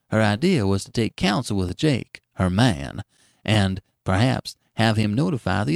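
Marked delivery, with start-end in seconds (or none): none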